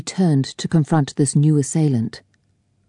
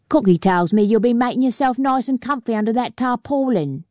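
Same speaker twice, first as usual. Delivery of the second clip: higher sly Cockney jeer; teasing Londoner; 7 semitones higher than usual. A narrator's reading. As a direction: needle him like a grinning Londoner. Londoner voiced in story.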